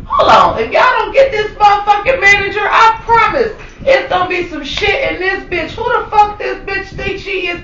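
high-pitched shouting tirade; acted-out outrage. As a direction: rant like a furious coworker demanding action. Acts out louder coworker's outrage.

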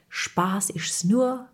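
slow whisper of a wise saying; hushed teacher's saying. Retold or imitated. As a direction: whisper it slowly like a lesson to remember.

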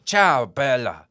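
a hammed-up Italian accent with an Irish lilt, slow; mock Irish-Italian greeting. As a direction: play an Irishman faking Italian. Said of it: "impersonate an Irish person pretending to be Italian".

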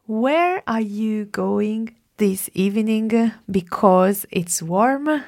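sentence read slowly for translation. Shown as a demonstration. Teacher slowly reads the sentence for the student to translate. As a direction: dictate it clearly. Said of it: "la prima frase".

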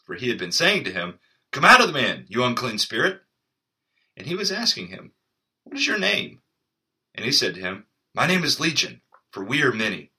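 steady, measured Bible reading voice; scripture reading. A narrator's reading. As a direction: read the passage clearly to the listeners. Reads scripture passage aloud.

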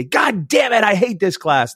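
put-on high, frustrated teenage curse; mock teen outburst. Teen handed an F grumbling at teacher, high frustrated outburst. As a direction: blurt it like a sulky fifteen-year-old. Teen cursing after failing test.

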